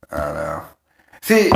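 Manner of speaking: normal talking voice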